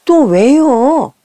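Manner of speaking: put-on high, drawn-out grumpy kid's whine; sullen retort. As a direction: imitate a child fed up at being called back. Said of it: "하고 퉁명스럽게 말합니다".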